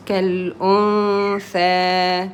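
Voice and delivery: slow كالأنثى, final vowel held two beats; two-count long vowel. Shown as a demonstration. Teacher models two periods for a learner, slowly stretching كالأنثى. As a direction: draw out the hummed n and last vowel of كالأنثى. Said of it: "there is two periods".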